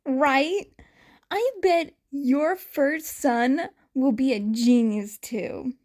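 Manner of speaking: bright teasing jab. A narrator's reading. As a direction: needle him cheerfully. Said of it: "Yamada followed up".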